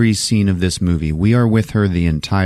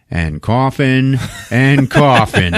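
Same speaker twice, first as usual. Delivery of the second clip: "coughing" stretched slow and higher each time; drawn-out repetition; 4 semitones higher, much slower than usual. Retold or imitated. Teller mocks the doctor's endless fit, "coughing" stretched and rising. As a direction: drag out each "coughing", building for comedy.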